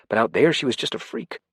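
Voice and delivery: plain reading voice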